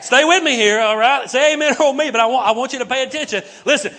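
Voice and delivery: loud, high call to stay with him; rally the room to keep listening; loud preacher urging the congregation. Real, not acted.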